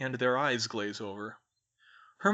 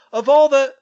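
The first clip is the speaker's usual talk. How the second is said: very high, very loud exclamation; furious outburst; 13 semitones higher, 18 dB louder than usual. A narrator's reading. Malfoy advancing on Hermione, furious shouted outburst. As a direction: explode in fury, cut off mid-phrase.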